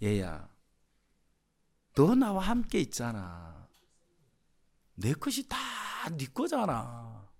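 soft, slow fatherly murmur; quiet loving father voice; tender father. Acted. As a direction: gently coax the son. Father gently addressing elder son.

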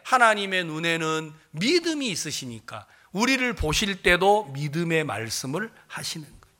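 ordinary talk, nothing marked

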